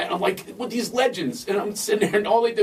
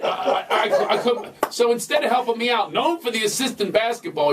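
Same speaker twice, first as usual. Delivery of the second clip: ordinary talk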